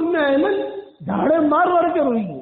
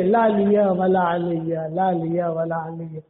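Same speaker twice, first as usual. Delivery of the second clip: dying man's plea; 8 semitones lower, slower than usual. Retold or imitated. Preacher voicing Umar's dying words, low, slow and fading. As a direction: let it sink and fade like a last breath. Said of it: "ایک ہی بات کہہ رہے تھے".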